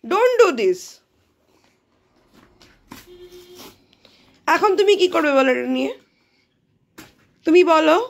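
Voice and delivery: high loud mom telling off; exasperated scolding. Real, not acted. Telling child to put toothpaste down.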